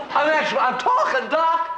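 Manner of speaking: high-pitched, rising excitement; overjoyed. Acted. Patient finds he is cured and tells Doc, thrilled and high. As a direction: marvel at your own voice working.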